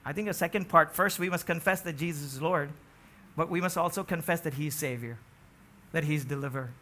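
ordinary talk

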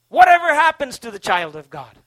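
loud, rising sermon delivery, pressing each phrase; fervent pulpit preaching; impassioned preaching. Acted. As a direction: preach it out to the whole room with conviction. Preaching full force to congregation.

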